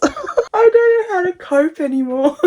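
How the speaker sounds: high, laughing helpless wail; overwhelmed wail. Real, not acted. Reader can't cope with the book, high overwhelmed wail.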